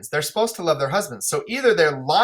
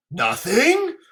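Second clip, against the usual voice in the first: Lear's disbelief; 6 semitones higher than usual. Acted. Lear incredulous.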